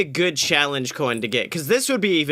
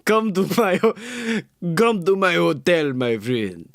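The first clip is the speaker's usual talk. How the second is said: put-on Eastern European accent. Goblin luring a new friend to a hotel, Eastern European accent. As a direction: invite them in a thick Eastern European accent. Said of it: "it's very, very Eastern European".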